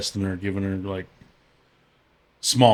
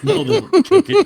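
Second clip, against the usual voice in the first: much higher, louder, laughing mock dad; 8 dB louder than usual. Friend quotes the dad while laughing hard. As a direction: crack up while quoting the dad. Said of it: "And he's like".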